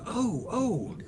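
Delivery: high, soft double take; mild, high-pitched surprise; retold surprise. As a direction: act out spotting something from afar. Retold animator spotting the print.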